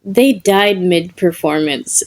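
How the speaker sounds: ordinary delivery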